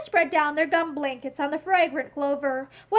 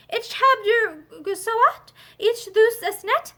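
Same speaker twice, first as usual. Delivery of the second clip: stubborn German, slow and higher; German refusal; 5 semitones higher, slower than usual. A narrator's reading. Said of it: "insisted John".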